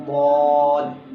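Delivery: دواد said thick, loud and drawn out; heavy recited دواد; heavy rounded daad. Shown as a demonstration. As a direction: round the mouth and stretch دواد. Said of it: "دواد میں بھی ایسے ہی ہوگا".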